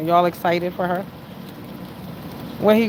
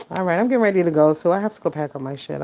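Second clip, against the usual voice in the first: ordinary talk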